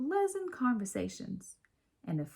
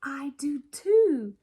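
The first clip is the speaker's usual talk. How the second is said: louder, slow and higher; eager agreement; 6 semitones higher, 8 dB louder, slower than usual. A narrator's reading. Chester tells Lily eagerly, louder and higher. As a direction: chime in eagerly. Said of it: "said Chester".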